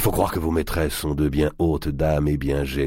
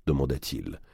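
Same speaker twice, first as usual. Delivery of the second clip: plain reading voice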